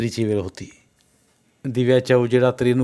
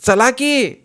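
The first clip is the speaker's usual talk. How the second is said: high, raised voice, unable to hold back; loud impatient call; 13 semitones higher, 6 dB louder, much slower than usual. A narrator's reading. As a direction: call out loudly and urgently. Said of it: "न राहून मोठ्यानं म्हणाली". (मोठ्यानं, अधीर हाक)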